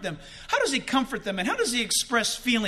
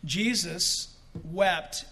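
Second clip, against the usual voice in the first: slow reading; 5 semitones lower, 4 dB quieter, much slower than usual. Shown as a demonstration. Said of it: "The point is".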